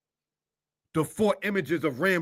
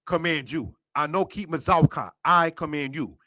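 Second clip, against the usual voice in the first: normal talking voice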